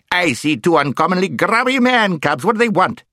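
high, loud, crotchety voice; brusque deaf dwarf. A narrator's reading. Deaf dwarf eyeing two grubby children, loud and brusque. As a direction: blare it out as if hard of hearing.

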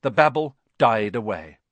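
ordinary delivery, nothing marked

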